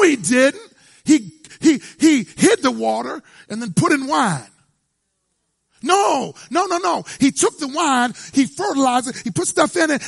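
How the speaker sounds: loud, high-pitched revival preaching; full-force preaching. Acted. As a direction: drive it home to the congregation.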